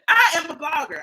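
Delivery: loud, emphatic self-declaration, mocking; mock proclamation. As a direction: proclaim it loudly in mockery. Mimicking the woman's claim.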